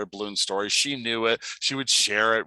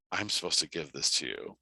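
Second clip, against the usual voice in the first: low, quiet, understated delivery; soft retold child; 7 semitones lower, 7 dB quieter than usual. Little boy handing a balloon to a stranger, soft and low. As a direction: say it softly and simply.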